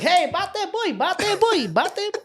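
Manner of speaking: high-pitched mock Filipino accent; mimicking uncle's accent. Cousins making fun of their uncle, mock Filipino accent. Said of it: "making fun of my uncle".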